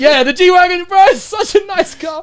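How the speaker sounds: high, shouted enthusiasm; excited outburst. Real, not acted. Speaker gushing over the coach's G-Wagon, excited shout. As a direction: shout it out, raving about the car. Excited interjection about the car.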